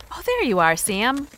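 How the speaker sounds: normal talking voice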